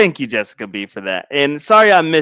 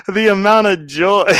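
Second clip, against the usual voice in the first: ordinary talk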